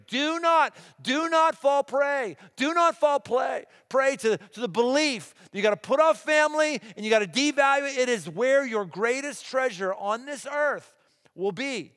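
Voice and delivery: loud, high, emphatic warning; impassioned preaching. Real, not acted. Preacher warning parents not to devalue family, impassioned. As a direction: preach it at full force, hammering each phrase.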